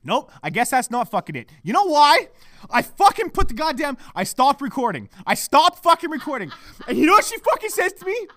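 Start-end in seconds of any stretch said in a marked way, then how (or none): 1.6-8.3 loud, high indignant outburst; outraged. Real, not acted. Podcaster restarting recording to report fiancée's words, outraged. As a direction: tell on her with rising outrage.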